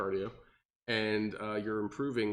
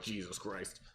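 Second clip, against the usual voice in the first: strained mutter; 2 semitones lower, 8 dB quieter than usual. Retold or imitated. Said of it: "you're just like".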